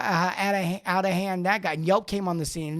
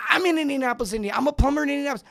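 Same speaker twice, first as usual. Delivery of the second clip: a bit higher and louder, eagerly volunteering; eager plumber; 7 semitones higher, 4 dB louder, much faster than usual. Retold or imitated. Plumber eagerly answering the customer's search, pitched up.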